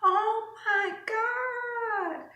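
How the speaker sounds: high, slow, shocked mock voice; imitating the nail lady. Nail lady gasps at how big the customer got, high slow shocked mock voice. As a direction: ham up the lady's high gasp of disbelief, laughing. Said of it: "the lady looked at me like".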